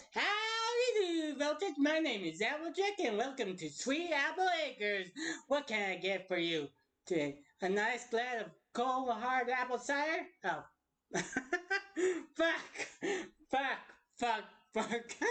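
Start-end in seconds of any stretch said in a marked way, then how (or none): 0.1-10.3 Applejack. Acted. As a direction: welcome customers in a put-on cowgirl accent. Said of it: "I should try Applejack next".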